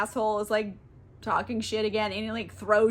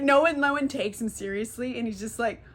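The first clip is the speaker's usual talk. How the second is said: normal talking voice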